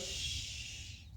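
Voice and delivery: a soft whispered shush to settle the mare; calming shush. Real, not acted. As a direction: hush her gently, barely a breath. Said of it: "I just use my little calming voice".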